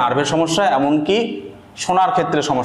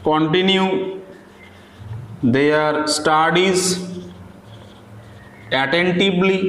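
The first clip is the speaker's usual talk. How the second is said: slow, word-by-word dictation with long pauses; 3 semitones higher, 4 dB quieter, much slower than usual. Acted. Teacher dictating a paragraph to students, slow and deliberate. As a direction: read each word out clearly so students can write it down.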